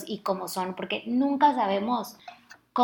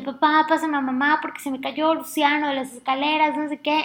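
high, loud, urgent asking for mom; retold panic on the phone; desperate plea; 7 semitones higher, 4 dB louder than usual. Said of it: "en la desesperación". (pidiendo a su papá que le pase a mamá, con desesperación aguda)

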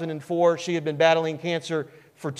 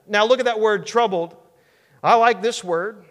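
usual speaking voice

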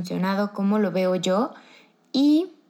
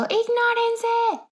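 mock mom yell, very high and drawn out; much slower than usual. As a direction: imitate an annoyed mother's long high cry.

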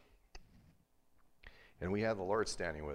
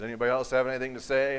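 higher, louder and fast; stern challenge; 7 semitones higher, 12 dB louder, faster than usual. Retold or imitated. Teacher daring the silent class to object. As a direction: dare the room to answer.

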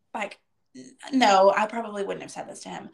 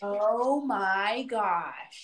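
very slow, drawn-out exasperation; long-suffering mock groan of words; exasperated; much slower than usual. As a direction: stretch every word in retold disbelief. Retold exasperation at pushy salesman.

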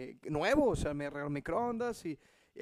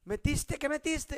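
higher accusing question; mock angry; 8 semitones higher, slower than usual. Wife interrogating him about the microwave, mock angry. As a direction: imitate the angry wife, lightly. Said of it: "bien enojada".

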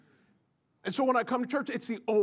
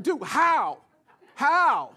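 exasperated disbelief; 5 semitones higher, 7 dB louder, much slower than usual. Retold or imitated. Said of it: "I asked, I said".

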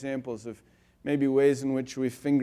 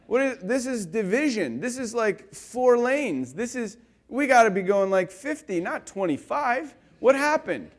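impatient rant; 6 semitones higher than usual. Retold or imitated. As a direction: rant at the crawling traffic, pitched up. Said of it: "I got impatient".

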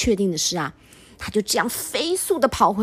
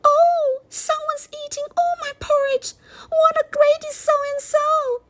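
very high squeaky little bear; squeaky baby bear; 4 dB louder than usual. Acted. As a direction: squeak like a tiny bear cub. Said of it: "the baby bear squeaked".

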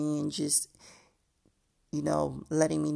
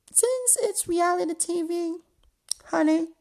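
sassy aside; 13 semitones higher, 3 dB louder than usual. Real, not acted.

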